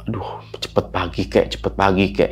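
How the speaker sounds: quiet pleading. Retold or imitated. Sick, begging for morning.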